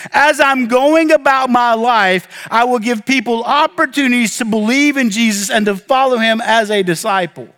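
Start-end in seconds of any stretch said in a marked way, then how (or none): 0.1-2.5 slow, raised "as I'm going" with the weight on "going"; stressed as I'm going. Shown as a demonstration. Pastor drives home his translation, slow and raised. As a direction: draw out "as I'm going" to make the point. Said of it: "is the literal translation".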